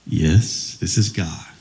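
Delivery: deep, low confirmation; voice of God. Acted. God confirms it to the jogger, deep voice. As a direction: answer low and steady as the Almighty. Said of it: "And the voice says".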